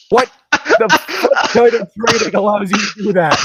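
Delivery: loud, high incredulous yell; exasperated outburst. Real, not acted. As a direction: rant at the ridiculous story. Outraged by the absurd training.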